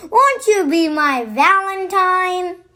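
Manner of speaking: plain reading voice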